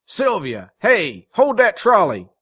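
high, loud calls, building in force; urgent shout. A narrator's reading. Man running after the trolley, urgent shouting. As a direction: holler to stop the trolley.

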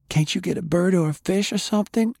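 put-on very high, eager child's question; a small girl grasping for another idea; hopeful little girl. A narrator's reading. Girl asking in story.

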